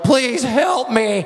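very high, stretched-out mock wail; hammed-up cry for help, slow and high; imitated desperate plea. Mother wading into the lake begs help, mock-desperate wail.

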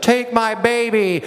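high, drawn-out mock cry; imitated frantic plea. Mother thrusts her baby at a stranger, mock-frantic cry. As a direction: imitate a desperate mother calling out slowly and high.

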